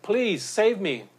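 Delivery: desperate mock cry for rescue; calling out for rescue. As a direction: cry out like a drowning swimmer. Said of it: "he calls out".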